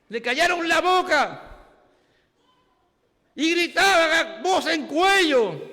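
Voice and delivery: loud, high shouted sermon; fervent preaching. Acted. Pastor preaches to the congregation, shouting fervently. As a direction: preach it at full force.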